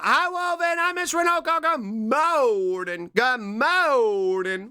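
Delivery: high, slow sing-song good morning; high cutesy greeting. Pretend. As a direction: stretch the morning out, sweet and high. Meme greeting voice.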